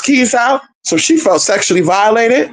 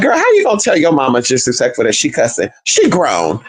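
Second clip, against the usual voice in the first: ordinary talk, nothing marked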